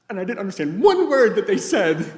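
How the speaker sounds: high, loud outburst with laughter in it; imitated exasperated complaint. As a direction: wail it in mock frustration. Acted wife's exasperated complaint.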